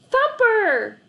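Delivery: high, loud cry of joyful recognition; excited recognition. A narrator's reading. Boy recognizing his old puppy at the door, high, loud and overjoyed. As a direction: call out the dog's name in delight.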